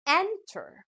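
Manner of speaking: "Enter" with EN high and prominent; stress on first syllable. Shown as a demonstration. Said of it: "stress is given on the first syllable".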